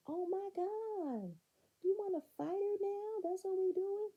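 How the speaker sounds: very high, put-on voice; hammed-up high indignation; mock shocked outcry. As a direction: imitate her scandalized and high-pitched.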